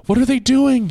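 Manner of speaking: put-on a scared kid calling out in panic, pitched high; high, shaken voice. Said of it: "in a high, shaken voice".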